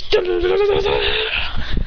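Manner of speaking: sung dun-dun sting; dramatic music. Retold or imitated. Narrator adding a dramatic theme, sung dun dun. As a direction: hum a dramatic music cue. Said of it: "He goes".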